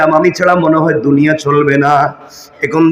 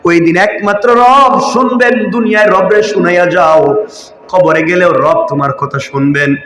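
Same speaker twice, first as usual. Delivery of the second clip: ordinary talk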